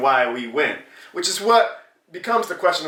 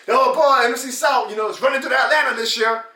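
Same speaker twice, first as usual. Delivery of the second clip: higher, hammed-up hype; mock delusional Falcons fan; 8 semitones higher than usual. Vlogger mocking Falcons fans' hype, higher mock voice. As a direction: gush like a deluded rival fan. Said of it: "delusional Falcons fans who was like".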